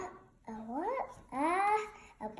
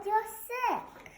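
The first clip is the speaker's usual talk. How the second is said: excited; 6 semitones higher than usual. Pretend. Happy Piggie voice.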